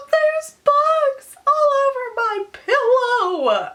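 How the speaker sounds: gasp, then a high drawn-out wail; hammed-up sobbing squeal, dragged slowly; mock blubbering. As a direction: imitate a blubbering meltdown. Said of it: "I'm still, like, blubbering".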